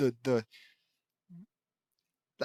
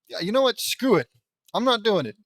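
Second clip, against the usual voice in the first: higher, louder fed-up refusal; exasperated; 6 semitones higher, 5 dB louder than usual. Real, not acted. Reader abandoning the Swedish accent, fed up. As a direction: throw in the towel, emphatically.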